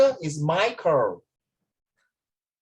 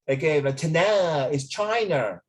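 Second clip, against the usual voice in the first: ordinary delivery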